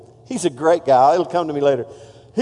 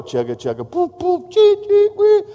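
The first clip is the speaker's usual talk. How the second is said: chugga-chugga then rising whistle, climbing much higher; steamboat chugging noise; 11 semitones higher than usual. Retold or imitated. Pastor sounding out the steamboat engine for the congregation.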